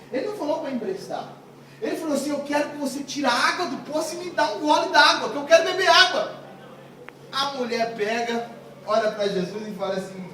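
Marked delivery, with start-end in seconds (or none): 2.3-6.3 acted loud, higher-pitched demand; blunt demand. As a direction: demand it emphatically, getting louder. Jesus's blunt demand, set against borrowing. (exigência firme em voz alta)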